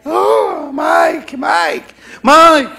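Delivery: high, loud shrieking fan calling his name; screaming fan girl. Retold or imitated. Fan girl screaming for Mike, high shriek. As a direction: shriek like a fainting fan at a concert. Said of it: "La muchacha".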